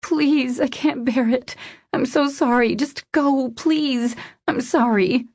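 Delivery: high, distressed apology; upset woman begging to be left alone. A narrator's reading. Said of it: "she begged, her voice muffled".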